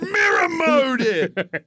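high, hammed-up voice, stretching the last words; high comic cry of a manic boss; mock yelling designer. Playing a game designer pitching mirror mode, mock yelling.

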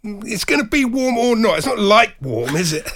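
loud, high, sneering retort; mocking retort. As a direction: throw it back at him, mocking. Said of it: "I was like".

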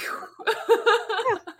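high-pitched real laughter. Real, not acted. Speaker laughing at her mix-up over bow, high laugh. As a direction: crack up in a high giggle.

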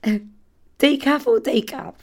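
slow sing-song host offer; a hammed-up polite host voice; mock hostess. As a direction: offer it slowly like a grand hostess. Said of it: "Someone comes round".

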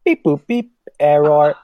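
loud robot beeps and errors; glitching robot. Pretend. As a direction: play a malfunctioning robot. Co-host introduced, answers as robot.

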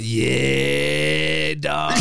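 a long stretched yeah dropping very low on dog; longest yeah. Retold or imitated. Friend imitating the phone greeting, long lazy drawn yeah. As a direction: drag the yeah out lazily, then sink down. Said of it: "Like the longest "yeah" as well".

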